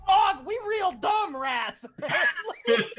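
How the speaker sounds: high slow rat, laughing. Acted. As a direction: giggle through a slow rat line.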